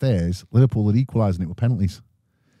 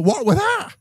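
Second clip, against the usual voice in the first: much higher, squealing mum; mum's shriek. Retold or imitated. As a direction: shriek it like a panicked mother. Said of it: "My mother".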